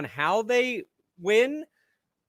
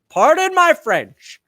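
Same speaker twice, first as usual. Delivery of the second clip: mock apology; 10 semitones higher, 11 dB louder, slower than usual.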